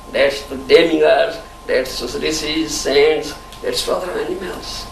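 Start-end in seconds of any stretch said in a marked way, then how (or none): none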